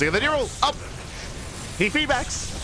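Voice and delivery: excited shout. Real, not acted. Caster hyped by neural into feedback, high excited call. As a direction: voice leaps high on the combo. Caster reacting to repeat combo.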